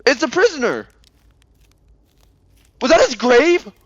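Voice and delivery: high-pitched, loud startled cry; stunned discovery, voice leaping way up; shocked realization. Real, not acted. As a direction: blurt it out in real shock. Shocked discovery during the game.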